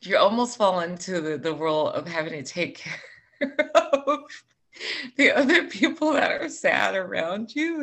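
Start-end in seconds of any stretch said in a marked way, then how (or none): 2.8-7.8 real laughter breaking into the words; laughing. Real, not acted. Telling a friend about comforting sad family, laughing. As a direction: laugh your way through it, higher at the end.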